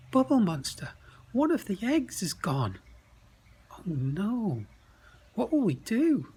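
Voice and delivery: high, loud panicked calling out; alarmed cry. Acted. Crinklebutt tells Bubble Monster an egg vanished, in loud alarm.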